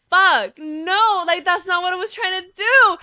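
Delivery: high, loud insistent denial; alarmed protest. Retold or imitated. Friend denying she was making fun, alarmed protest. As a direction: burst out defending yourself.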